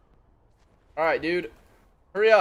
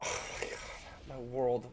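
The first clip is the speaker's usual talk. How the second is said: normal talking voice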